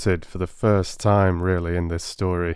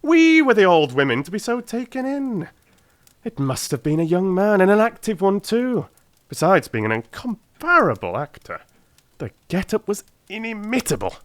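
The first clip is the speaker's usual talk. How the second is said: higher, louder self-mocking declaration; Holmes, rueful and brisk; 13 semitones higher, 4 dB louder than usual. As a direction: admit being fooled with relish. Holmes voiced in reading.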